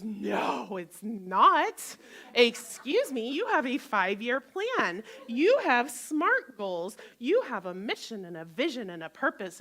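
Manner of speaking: loud, insistent nagging brain; hammed-up scolding inner voice; inner critic voice. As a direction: bark it like a pushy inner critic. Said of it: "my brain goes".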